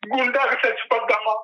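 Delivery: ordinary talk, nothing marked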